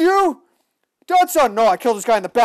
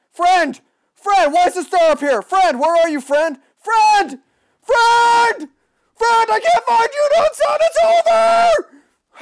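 high, loud shouts rising to a drawn-out wail; desperate calling; 12 semitones higher, 4 dB louder, slower than usual. Real, not acted. Gamer desperately searches for friend, loud calling. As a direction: call out for a lost friend.

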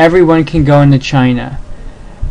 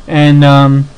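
ordinary delivery

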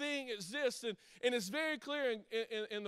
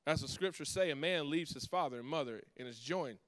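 scripture reading; 4 semitones lower than usual. Acted. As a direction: read the Word reverently, letting it sink in. Reads scripture passage aloud.

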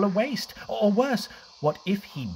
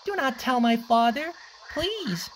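high, begging little croc; pleading; 10 semitones higher than usual. Acted. Cliff begging the hyenas not to tell, high pleading. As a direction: plead desperately with the bullies. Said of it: "begged the mean hyenas".

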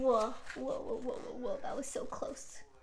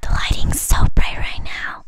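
leaning in to whisper it; 21 dB louder than usual. Shown as a demonstration. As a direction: hush it, breathy and slow. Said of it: "I'm trying to whisper for fun".

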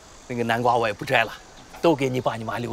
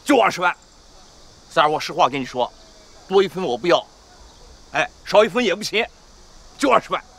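louder and higher, refusing to budge; firm insistence; 6 semitones higher, 5 dB louder than usual. Acted. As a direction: dig in on the price, building force. Uncle insists on price.

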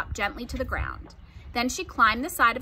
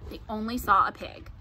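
ordinary delivery

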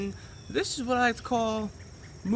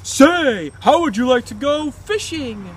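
sitcom dad; 7 semitones higher, 7 dB louder, slower than usual. Retold or imitated. Voicing Brian's cheery dad.

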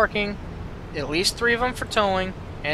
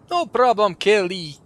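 "No problem, Kelly" redone higher and louder in a Russian accent; mock Russian accent; 3 semitones higher, 5 dB louder than usual. Shown as a demonstration. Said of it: "I gave him a Scottish accent".

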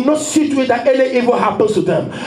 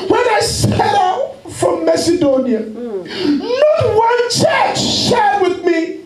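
full-force preaching; 6 semitones higher than usual. Acted. Pastor to church, voicing Paul in full preaching cry. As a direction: thunder the apostle's words.

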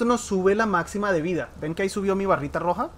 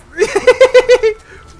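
high, loud cackle; loud burst of laughter; 14 semitones higher, 12 dB louder than usual. Real, not acted. Gamer cracking up after a close call.

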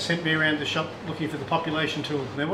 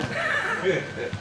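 amused laugh. Real, not acted. Laughs at the story.

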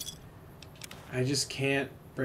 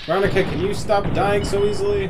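higher and much louder, fed-up shout at a teammate; exasperated yell; 8 semitones higher, 11 dB louder than usual. Real, not acted. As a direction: yell at the companion who keeps dying. Yelling at companion in fight.

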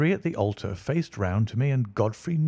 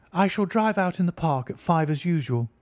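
a hint of a higher lady's voice; lightly voiced lady; 7 semitones higher than usual. A narrator's reading. Irene telling Godfrey her plans, lightly voiced. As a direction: say it lightly as the bride leaving. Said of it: "she said as she left him".

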